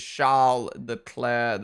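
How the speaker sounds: Charles Leclerc said as SHARL luh-CLAIR; French-style name. Shown as a demonstration. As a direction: silent final c, sh to start Charles Leclerc. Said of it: "I bet everyone here says".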